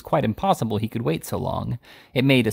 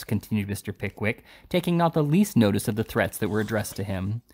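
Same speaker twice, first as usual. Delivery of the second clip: plain reading voice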